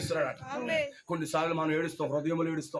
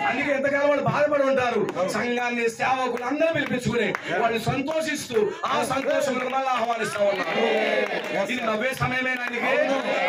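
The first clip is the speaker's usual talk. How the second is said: raised, exultant preacher's voice; full-force preaching; 5 semitones higher, 4 dB louder than usual. Real, not acted. Preacher rejoicing with a family at their gathering, exultant preaching. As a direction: proclaim joy to the gathered church.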